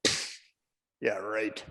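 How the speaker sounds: scornful little puff, then unimpressed; low-key rabbit scoff; dismissive rabbit. Acted. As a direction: brush the dog off under your breath. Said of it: "the rabbit just looks at my dog and he goes".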